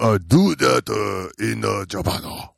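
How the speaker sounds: hammed-up mock Japanese accent. Shown as a demonstration. Host showing how he does it, mock Japanese accent. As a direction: put on a halting Japanese accent with added vowels. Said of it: "This is how I do a Japanese accent".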